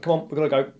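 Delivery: urgent. Retold or imitated. Telling his young daughter they must leave now, urgent and loud. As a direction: hurry the child out the door.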